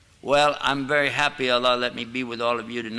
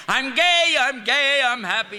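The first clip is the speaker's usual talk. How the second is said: much higher, louder gleeful shout of joy; mock excited cry; 10 semitones higher, 4 dB louder than usual. Old man mimicking the joyful slang of his youth, much higher and louder. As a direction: run out bursting with happiness, hammed up. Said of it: "When you were excited and happy".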